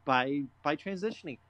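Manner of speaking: normal talking voice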